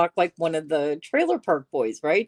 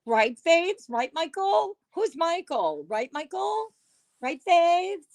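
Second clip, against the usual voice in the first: catchphrase mimicry; 10 semitones higher than usual. Repeating catchphrase mockingly.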